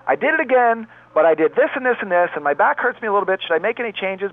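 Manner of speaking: imitating the client. Client calling the therapist after a bike fall, imitated high and upbeat. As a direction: lift high and chirpy to play the cheerful client. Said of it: "She'll call us up and go".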